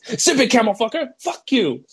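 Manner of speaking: high-pitched mock outburst; Bobby. Teammate lashing out at the coach from nowhere, mock outburst. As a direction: imitate a player's sudden high retort. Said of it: "Bobby out of nowhere says".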